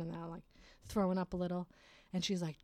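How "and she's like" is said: usual speaking voice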